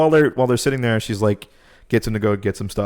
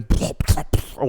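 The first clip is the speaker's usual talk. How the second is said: imitated body-horror plops; plopping noises; 3 semitones higher, slower than usual. Storyteller imitating the monster's body horror, plopping noises. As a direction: make wet popping plop sounds with the mouth. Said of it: "like, plopping noises alongside it".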